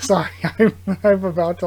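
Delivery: breaking up laughing mid-sentence. Real, not acted. Reader cracking up at the panel, laughing. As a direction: apologize through the giggles. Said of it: "like, cry laughing".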